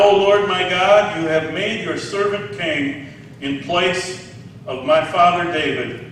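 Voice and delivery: ordinary talk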